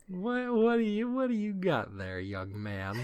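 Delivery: a playful high tease at a sneaky little one; teasing coo. Acted. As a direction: coax a sly kid to show his toy. Teasing Rung about his gadget.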